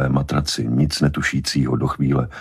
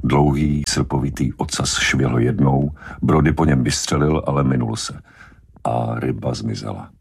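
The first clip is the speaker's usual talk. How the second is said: plain reading voice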